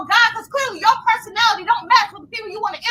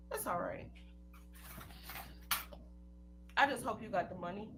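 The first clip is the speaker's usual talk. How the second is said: quiet threat; 10 semitones lower, 6 dB quieter than usual. Real, not acted. Quiet menacing warning.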